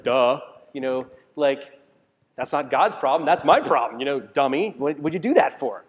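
loud mock scold at himself; half-joking scold at his own folly; self-scolding. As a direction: chide yourself like a fool.

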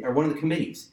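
ordinary delivery

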